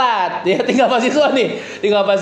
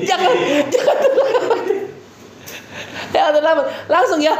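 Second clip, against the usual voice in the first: laughing; 7 semitones higher than usual. Real, not acted. Laughing while correcting students.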